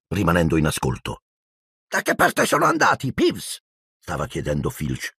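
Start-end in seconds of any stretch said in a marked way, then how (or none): 1.9-3.6 angry caretaker. A narrator's reading. Filch interrogating Peeves.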